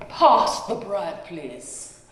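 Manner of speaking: slow, lightly put-on French accent; a hint of a prim French accent at the dinner table; flat French accent. A narrator's reading. Mrs Proper asking her grandchild at table, mild French accent. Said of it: "as if she were in a French restaurant".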